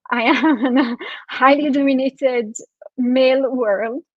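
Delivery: usual speaking voice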